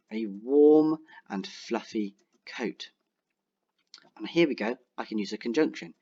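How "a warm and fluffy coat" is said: slow dictation. Shown as a demonstration. Dictating while typing.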